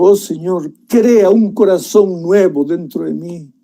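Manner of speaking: David in the cave begs God for forgiveness, tearfully pleading; tearful plea. Acted. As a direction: beg God for mercy, half in tears. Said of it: "Y llorando le dijo".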